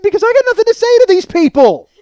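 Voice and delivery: loud, high-pitched comic yelling; worked-up, defensive rant at full volume; exasperated outburst. Acted. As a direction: shout the excuse in total frustration. Exasperated shouted justification to wife.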